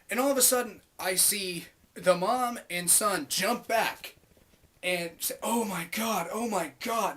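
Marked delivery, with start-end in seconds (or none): 5.4-7.2 raised-pitch retold alarm; mock freaking out. Mom and son jumping back from cart, mock freaking out. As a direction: imitate a mild panic. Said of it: "like, freaking out".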